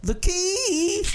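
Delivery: very high, drawn-out impression voice; Bill Cosby. Shown as a demonstration. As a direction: squeeze out a rusty, high-pitched celebrity impression. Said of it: "make me do Bill Cosby".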